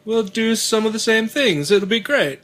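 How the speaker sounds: high, cheery salesman voice. Retold or imitated. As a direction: sell it like an eager recruiter. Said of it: "what does Christianity have to offer".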